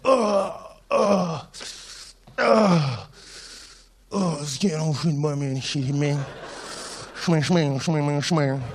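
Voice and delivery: mock gasping death scene; a lightly voiced overacted death; hammed-up dying man. Friend dying after being shot.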